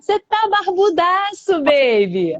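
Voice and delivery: drawn-out, high and loud playful tease; delighted teasing. Real, not acted. Host greets the bearded friend joining her live, delighted and teasing. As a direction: gush over his big beard.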